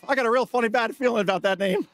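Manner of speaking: wife's reaction to the Karen name, in a high wary voice. Retold or imitated. Wife quoted again.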